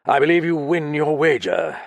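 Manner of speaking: a touch of stiff reserve; stiff, cold concession; stiffly. A narrator's reading. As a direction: say it stiffly, holding back. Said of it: "he added stiffly".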